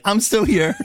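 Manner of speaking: very high, piping call; high-pitched comic interjection; Mikey. Acted. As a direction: pipe up in a squeaky cartoon voice. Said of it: "Mikey's just like".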